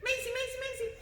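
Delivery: high-pitched sing-song name calls; lilting high dog call. Real, not acted. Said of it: "High voice".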